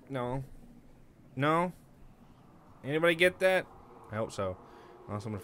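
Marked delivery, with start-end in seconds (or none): none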